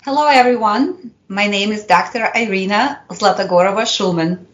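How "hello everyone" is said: usual speaking voice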